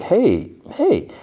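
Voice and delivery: high-pitched acted "hey"; retold waking alarm. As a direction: call out as someone waking up cuffed. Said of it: "He's, like, groggy".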